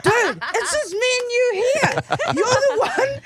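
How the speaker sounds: high, laughing disbelief; laughing exasperation. Retold or imitated. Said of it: "I'm like".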